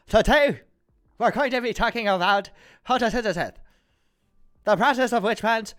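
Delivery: Anchorman voice. Pretend. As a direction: boom it like Ron Burgundy.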